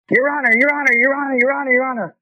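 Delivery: high-pitched, rapid panicked pleading; imitated frantic screaming. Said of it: "he started screaming at the top of his lungs".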